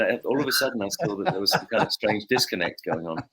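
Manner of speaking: usual speaking voice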